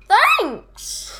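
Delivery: high, bright storybook exclamation; exclaimed thanks. A narrator's reading. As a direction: sing out thanks as the bear. Child voices Bear's thanks.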